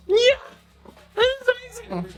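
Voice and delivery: high pet voice. Real, not acted. Streamer asking her dog what it's up to, high pet voice.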